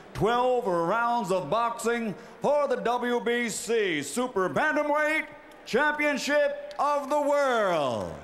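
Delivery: rising, drawn-out ring announcer build; lucha libre announcer. Acted. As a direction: stretch it out and climb for the title.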